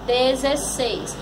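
"Dezesseis" as one word, moderately slow; palavra juntada. Shown as a demonstration. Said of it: "Formou que palavrinha?". ("Dezesseis" inteiro, um pouco devagar)

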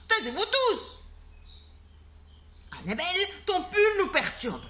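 a flustered schoolteacher losing patience; exasperated teacher. Acted. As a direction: shout the class down in a put-on voice. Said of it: "cria monsieur Normand".

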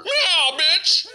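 high, loud sassy meow; sassy cat. Acted. Cat sassing the viewer, high and loud. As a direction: toss it off high and cheeky.

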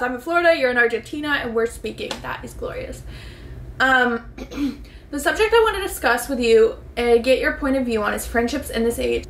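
usual speaking voice

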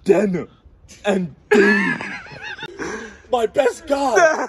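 loud, high, slow hammed-up lament; mock grief. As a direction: wail over lost henchmen, hammy. Boss hears his men died.

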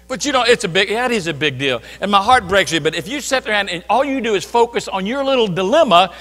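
usual speaking voice

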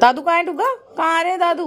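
high, loud eager question to the dog; excited call. Real, not acted. (कुत्ते से उत्साहित ऊँची आवाज़)

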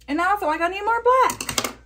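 high, sing-song silly voice; goofy high-pitched chant. Pretend.

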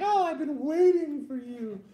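high, loud, drawn-out mock swoon; swooning lover. Imagined girlfriend welcoming him back, mock swooning.

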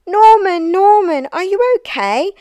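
high, loud, anxious calls; worried calling. A narrator's reading. Snails checking on fallen Norman, worried. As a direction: call out urgently to check on a friend. Snails worry after his fall.

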